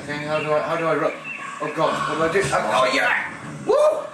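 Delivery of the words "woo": loud, very high whoop; excited whoop. Real, not acted. Player cheering in a zombie fight, high excited whoop. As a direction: let out a piercing woo.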